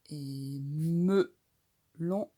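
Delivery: very slow Et melon; stretched melon; slow model word. Shown as a demonstration. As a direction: draw melon out syllable by syllable. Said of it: "on entend le me".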